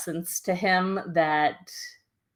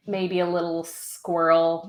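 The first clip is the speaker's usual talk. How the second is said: ordinary talk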